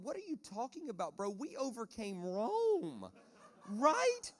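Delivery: an unbothered God shrugging off the panic; imitating a casual God amused at worried Christians; God in heaven. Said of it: "I can see God in heaven going".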